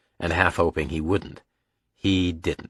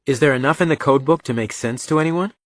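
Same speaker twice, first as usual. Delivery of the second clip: higher-pitched inquisitive son; Reacher; 7 semitones higher than usual. A narrator's reading. Son quizzes father about the code book, inquisitive. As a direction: lift the voice into a keen question.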